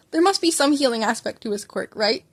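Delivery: plain reading voice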